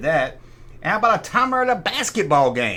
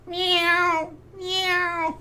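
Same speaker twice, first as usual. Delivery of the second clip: high squeaky meows; cat meow; 13 semitones higher, 4 dB quieter than usual. Pretend. Mocking the cat toy sound, high meow.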